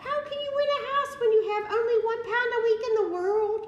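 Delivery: very high, loud, fast tirade; the aunt rages at her niece, fast and very high; furious shout. Acted. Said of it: "she shouted".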